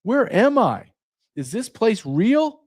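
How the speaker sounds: high-pitched, appalled astonishment; bewildered, pitched well up; stunned disbelief. Acted. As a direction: act out a newcomer's shock, voice climbing. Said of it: "you're just like".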